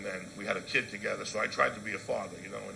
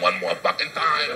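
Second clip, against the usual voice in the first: ordinary talk, nothing marked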